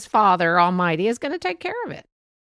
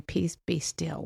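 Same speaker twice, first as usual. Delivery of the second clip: slow calm; 3 semitones lower, 6 dB quieter, slower than usual. Shown as a demonstration. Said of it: "just take that deep breath".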